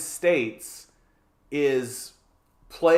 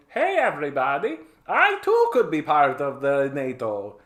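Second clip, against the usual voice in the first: put-on hopeful newcomer in a silly Russian accent; eager, badly faked Russian accent; mock Russian accent; 2 semitones higher than usual. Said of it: "I don't know why I'm using that accent".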